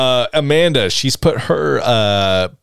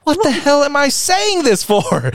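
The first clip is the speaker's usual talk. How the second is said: mock exasperation. Mock exasperation during heated argument.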